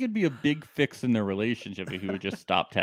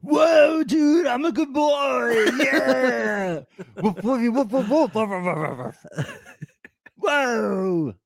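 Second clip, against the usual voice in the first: much higher, barking woofs; playful dog; 12 semitones higher, much slower than usual. Pretend. Host plays Luke as a dog, high yelping woofs. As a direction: bark like an excited puppy.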